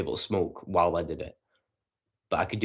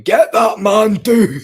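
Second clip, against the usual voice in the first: much higher, loud yelled street shout; imitating a drunk scream; 13 semitones higher, 12 dB louder, much slower than usual. Imitating Davey the drunk screaming outside the window, loud high yell. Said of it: "Davey outside your window screaming".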